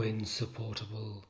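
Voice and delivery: ordinary talk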